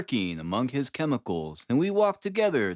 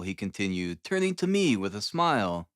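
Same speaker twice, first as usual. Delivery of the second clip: ordinary delivery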